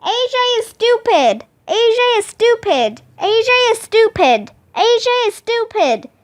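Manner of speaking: high, fast repeats of a taunt; quick, high mock-yell on repeat; yelled echo. A narrator's reading. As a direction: imitate an echo throwing the taunt back. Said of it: "yelled the gym".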